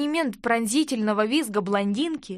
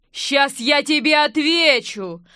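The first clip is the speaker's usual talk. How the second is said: loud, slow threat; drawn-out furious roar; losing his temper; 3 semitones higher, 6 dB louder, slower than usual. A narrator's reading. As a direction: threaten a fight at full voice. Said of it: "окончательно вышел из себя любовник".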